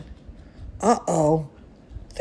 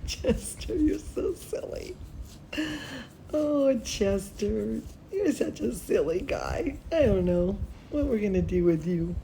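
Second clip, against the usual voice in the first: pet talk; 9 dB quieter than usual. Real, not acted. Teacher cooing to her dog.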